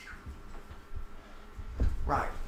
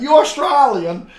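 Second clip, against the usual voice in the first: mock question; 13 semitones higher, 16 dB louder, slower than usual. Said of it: "people ask me".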